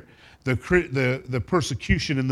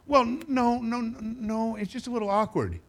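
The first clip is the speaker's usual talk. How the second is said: high put-on voice of a hedging churchgoer; awkward excuse; 10 semitones higher than usual. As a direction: squirm out of it in a mock high voice. Voicing an embarrassed churchgoer's excuse.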